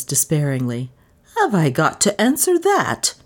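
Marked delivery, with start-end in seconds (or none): 1.4-3.1 high, pleading mock wail. A narrator's reading. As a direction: whine it at the mistress in dismay. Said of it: "she wailed".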